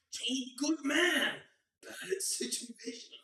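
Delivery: high, loud, slow sermon voice in broken English; preachy parent storyteller. Retold or imitated. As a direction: preach the story of Job like a strict dad. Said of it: "he'd be like".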